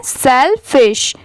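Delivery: सेलफिश said slowly as one word, falling; clear model word. Shown as a demonstration. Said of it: "इसे हम साथ में क्या पढ़ते हैं?". (सेलफिश धीरे और साफ़ बोलें)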